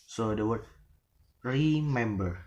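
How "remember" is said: "re" stretched with a long glide down; hesitant fall-rise. Shown as a demonstration. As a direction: draw out "remember", pitch sliding. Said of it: "like that".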